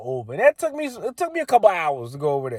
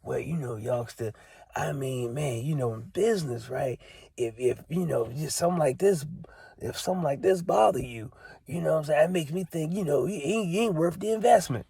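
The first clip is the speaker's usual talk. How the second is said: mocking condescending stranger; 8 dB quieter than usual. Mocking the stranger who lectured him at the gate, soft patronizing voice. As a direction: talk down to him, quiet and smug.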